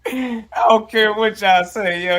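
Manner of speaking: high, loud laughing outburst; excited shout. Real, not acted.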